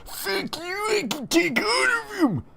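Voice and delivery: very high screeched comeback; hammed-up high-pitched screaming; Eric. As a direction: scream back in a squealing character voice. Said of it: "Eric screams back".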